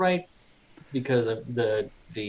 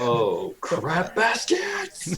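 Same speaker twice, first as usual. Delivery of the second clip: surprised exclamation; 6 semitones higher, much slower than usual. Real, not acted. Player exclaims at the damage roll.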